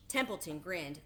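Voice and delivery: usual speaking voice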